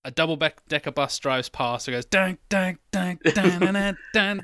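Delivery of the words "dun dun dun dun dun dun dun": higher, sung-out drum-beat imitation; sung soundtrack beat. Friend mimics a film soundtrack with a sung beat. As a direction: hum the dun-dun beat of a film score.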